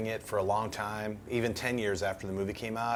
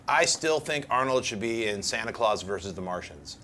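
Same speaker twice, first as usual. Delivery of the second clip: ordinary talk, nothing marked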